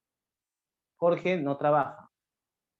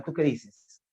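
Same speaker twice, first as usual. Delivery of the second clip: normal talking voice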